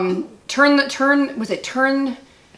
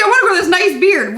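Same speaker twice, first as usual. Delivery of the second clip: mocking husband; 8 semitones higher, 3 dB louder than usual. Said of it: "He's like".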